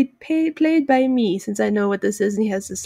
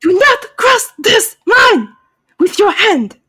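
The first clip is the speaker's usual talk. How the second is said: put-on high, loud, slow villain accent; outraged, badly faked accent; Kamun Ra; 8 semitones higher, 6 dB louder, slower than usual. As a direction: spell out the order in a hammed-up foreign accent. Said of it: "please forgive my horrible accent".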